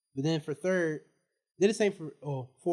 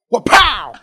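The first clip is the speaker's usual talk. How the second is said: shouted wa-pow, much louder and higher; loud crash sound effect; 6 semitones higher, 19 dB louder than usual. Retold or imitated. Boy imitating the bang of his fall for his friends, shouted. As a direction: bang it out like a body hitting the floor.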